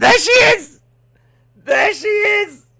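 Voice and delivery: high, loud delighted call; shouting as she appears on screen; excited spotting. Real, not acted. Spotting the woman on replay.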